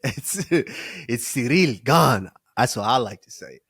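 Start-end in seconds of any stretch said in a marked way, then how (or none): 0.0-2.3 light French accent. Shown as a demonstration. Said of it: "put the little French accent on that".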